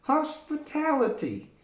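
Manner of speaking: high puppet voice says Hospitality. Acted. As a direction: let the puppet parrot Hospitality. Puppet repeats word.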